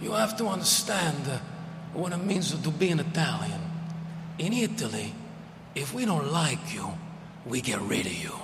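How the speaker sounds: put-on deep, deliberate Italian accent, dropping low at the end; a serious uncle's Italian accent with a quiet threat. Uncle warning the nephew about Italians, low Italian accent. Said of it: "that broken English, that Italian accent".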